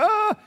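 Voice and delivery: high mock panicked "Ah!"; a quick mock scream; mock panic cry. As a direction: yelp like someone seeing the world scene. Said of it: "you're like".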